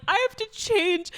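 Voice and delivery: panicked high quote. Retold or imitated. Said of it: "I was like".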